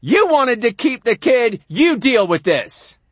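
loud, high angry holler; angry dad shouting before storming off; hollering father. Acted. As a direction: holler it at your wife. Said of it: "And my father hollers".

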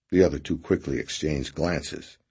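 usual speaking voice

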